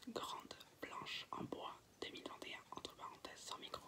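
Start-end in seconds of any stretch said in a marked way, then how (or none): none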